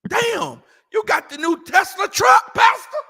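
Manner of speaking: loud, high teasing call to the pastor; shouting up at the pastor about his new truck; mock shout. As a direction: call out to him loud and mocking.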